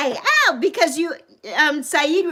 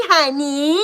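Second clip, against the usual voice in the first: ordinary talk, nothing marked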